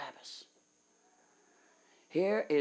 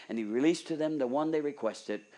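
ordinary talk, nothing marked

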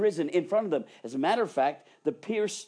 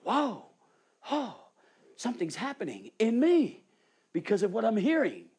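amazed wonder; 6 semitones higher, 4 dB louder than usual. Acted. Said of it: "cause you to".

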